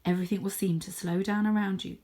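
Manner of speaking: plain reading voice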